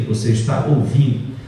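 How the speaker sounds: ordinary talk, nothing marked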